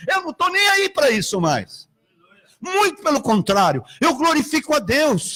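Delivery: preaching hard and high, calling out to the church; fervent preaching. Acted. Pastor to congregation, preaching at full force.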